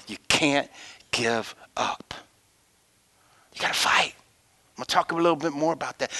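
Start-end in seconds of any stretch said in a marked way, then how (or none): 0.0-4.1 soft unvoiced whisper; whispered plea to keep fighting; hushed urging. Real, not acted. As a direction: drop to a whisper to urge them. Preacher drops to hush.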